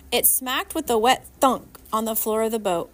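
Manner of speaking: plain reading voice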